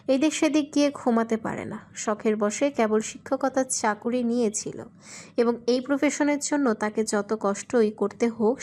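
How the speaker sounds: ordinary delivery, nothing marked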